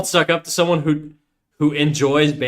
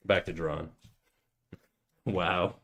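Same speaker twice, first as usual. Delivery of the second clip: ordinary delivery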